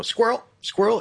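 high excited cartoon dog voice; suddenly distracted, squeaky dog. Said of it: "the old dog from, uh, from the movie Up".

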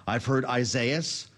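Isaias said slowly as eye-ZAY-us; variant pronunciation. Shown as a demonstration. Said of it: "I've heard four different pronouncers on this storm".

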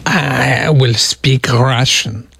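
slow, halting mock Russian accent. Shown as a demonstration. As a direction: drag it out in a rough Russian accent. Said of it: "No, it still doesn't sound right".

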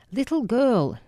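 put-on high sneering Dutch-accented old man. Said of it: "he sneered in his guttural Dutch accent".

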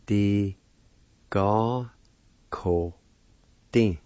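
teacher sounding out syllables; broken into syllables. Shown as a demonstration. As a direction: say D, Ga, ko, tin as separate beats with small pauses. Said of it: "is pronounced like this".

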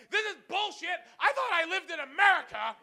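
high-pitched indignant yell; outraged yelling. Retold or imitated. Drunk-hungry customer storming out of a gas station, outraged yelling. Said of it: "kicks the door open yelling".

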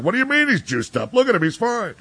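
high-pitched mock protest; imitating indignant defenders. Host voicing fans defending a ballplayer, high mock indignation. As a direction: pitch it way up, playing an incredulous fan.

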